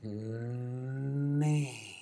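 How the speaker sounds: long, humming "me" felt in the chest; resonant me. Shown as a demonstration. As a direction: let "me" vibrate in the chest. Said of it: "Do it again so you can feel it".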